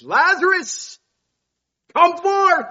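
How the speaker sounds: loud, high proclamation across a tomb; commanding call. Acted. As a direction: call the dead man out with full authority. Jesus calling Lazarus from grave.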